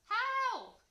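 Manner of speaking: very high, loud yell; cry for help. A narrator's reading. Dragon choking on smoke, panicked shout for help. Sparky in trouble calls out.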